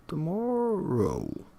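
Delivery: "Tomorrow" high and slow, rising then falling; insistent rise-fall. Shown as a demonstration. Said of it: "convey a degree of insistence".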